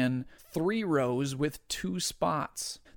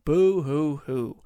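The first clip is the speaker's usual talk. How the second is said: slow, hammed-up baby sobbing; boo-hoo like a crybaby; fake crying; 5 dB louder, slower than usual. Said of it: "cry like a little baby".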